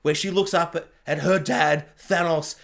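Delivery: normal talking voice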